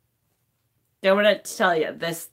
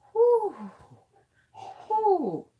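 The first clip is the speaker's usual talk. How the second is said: high shaky exhale; 12 semitones higher than usual. Real, not acted. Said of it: "laughs after crying".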